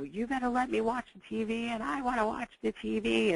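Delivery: put-on high, whiny kid demanding the TV; demanding child voice. Angry daughter demanding the TV from her mom, in a high whiny child voice.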